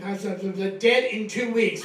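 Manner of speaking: loud, stammering doom from the next room; mock alarmed doctor. Comic retelling the doctor's overheard verdict, loud mock alarm.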